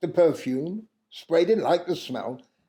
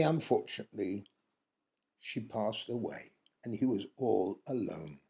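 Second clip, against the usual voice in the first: much softer and lower, grieving; sad, hushed; 7 semitones lower, 12 dB quieter than usual. A narrator's reading. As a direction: let the sorrow pull the voice right down. Wife's death in the story.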